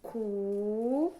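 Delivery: teacher sounding out "Cu" loudly; isolated syllable. Shown as a demonstration. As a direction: stretch "Cu" upward as a separate syllable. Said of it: "Eu abri a boca cinco vezes".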